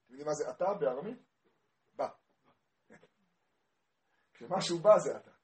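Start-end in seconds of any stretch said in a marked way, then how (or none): none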